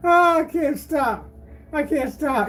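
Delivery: high, helpless voice between laughs; laughing so hard. Real, not acted. Streamer cracked up by his own joke, laughing hard. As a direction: squeeze the words out through real laughter. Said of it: "I'm laughing so hard".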